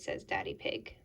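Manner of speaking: ordinary delivery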